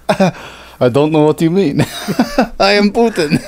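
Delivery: mock Putin in a shaky Russian accent; bad Russian accent. Host playing Putin to cohost, bad Russian accent. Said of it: "never been good at the Russian accent".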